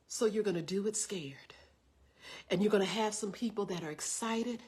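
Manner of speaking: low, hushed, steady encouragement; very calm. Retold or imitated. Big sister readying a scared preacher for critics, calm and hushed. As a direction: prepare them gently, voice kept soft. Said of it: "she was so calm".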